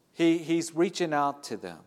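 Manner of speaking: ordinary talk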